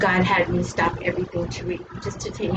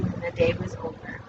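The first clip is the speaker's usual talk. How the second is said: ordinary delivery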